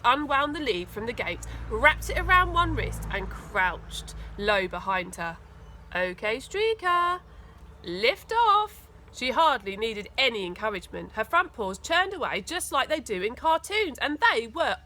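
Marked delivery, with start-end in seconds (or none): 5.9-8.9 higher, slow countdown-style launch call; excited launch. A narrator's reading. Trevor launching Streaker off, excited, higher and slow. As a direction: send the dog off like a rocket. Boy launches dog on skates.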